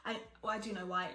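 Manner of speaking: ordinary talk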